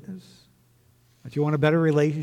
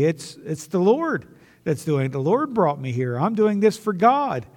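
loud, raised-pitch mimicry of pious excuses; mock self-righteous claim; 6 semitones higher, 6 dB louder than usual. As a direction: imitate someone loudly insisting on their own piety. Mimicking false claims of doing God's work.

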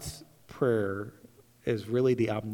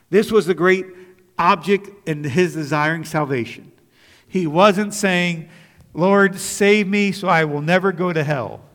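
forceful preaching; 5 semitones higher, 8 dB louder than usual. Acted. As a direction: lean hard into every word from the pulpit. Preacher stresses the point.

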